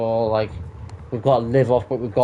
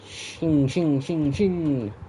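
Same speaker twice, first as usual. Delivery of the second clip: sword being drawn; 3 semitones higher, much slower than usual. Pretend. Pretend sword drawn in game.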